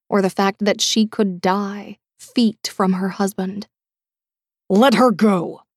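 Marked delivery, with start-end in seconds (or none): 4.7-5.6 hard order, no longer coaxing; deadly command. A narrator's reading. Dag orders the captor to release his wife, hard command. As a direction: bark it like a Navy seal. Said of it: "no longer cajoling and easy".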